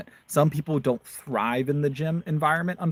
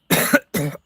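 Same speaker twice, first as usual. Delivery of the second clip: harsh cough from a swallowed bug; 2 semitones higher, 6 dB louder than usual. Real, not acted. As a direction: hack it out, choking. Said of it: "A bug just flew in my mouth".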